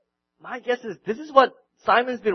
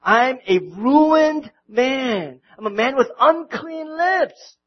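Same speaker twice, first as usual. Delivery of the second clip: slow, loud, stretched-out cry of woe; Isaiah's lament; 4 semitones higher, 9 dB louder, slower than usual. Retold or imitated. Isaiah cries out before God's glory, slow and loud. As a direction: drag each word out in loud despair. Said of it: "he fell down and he said".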